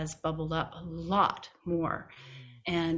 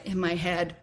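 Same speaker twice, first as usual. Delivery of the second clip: ordinary talk, nothing marked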